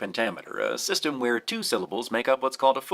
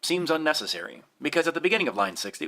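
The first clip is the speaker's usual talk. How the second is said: ordinary delivery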